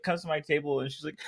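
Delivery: ordinary talk, nothing marked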